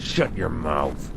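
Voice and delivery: soft, slow and cold; low menacing retort. Acted. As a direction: shut him down quietly, with menace. Curt retort to prying talker.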